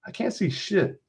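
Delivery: high-pitched whine of complaint; impatient, high character voice; frustrated complaint. A narrator's reading. Friend complaining while craning to see.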